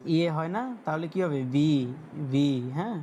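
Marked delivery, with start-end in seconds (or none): 1.4-2.7 ভি said with a long "ee"; pronunciation demo. Shown as a demonstration. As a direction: model ভি clearly, ending on "ee" not "ay". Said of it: "ভি এর মতো উচ্চারণ হয়".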